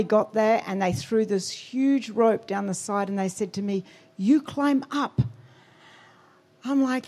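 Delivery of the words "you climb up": high, slow command, acted out; mock rescuer calling down; imitated rescuer's order. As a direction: order it slowly, pitched up.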